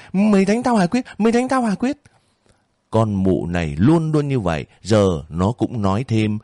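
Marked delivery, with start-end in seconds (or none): 0.1-1.0 landlady screaming at Quyết, high; shrieking. Acted. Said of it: "mụ đã la bay bải". 1.2-2.0 even higher shriek rising on "Quyết"; landlady shrieking again, higher still. Acted. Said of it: "mụ đã la bay bải".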